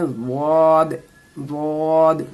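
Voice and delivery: वाद said thick and heavy; heavy waad. Shown as a demonstration. Teacher demonstrates heavy वाद. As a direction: make वाद full and heavy. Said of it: "इसको मोटी करके बोलनी है".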